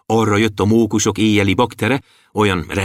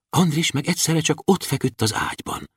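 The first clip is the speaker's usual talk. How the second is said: usual speaking voice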